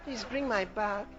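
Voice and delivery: low, soft, weary request; feeble, defeated plea; beaten-down worn-out person. Acted. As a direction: sound drained after heavy praying. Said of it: "think that God's been beating you".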